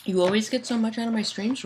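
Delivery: ordinary talk, nothing marked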